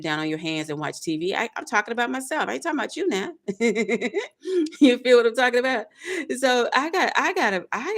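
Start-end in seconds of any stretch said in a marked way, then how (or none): 3.4-4.8 short laugh. Real, not acted. Laughs at own joke.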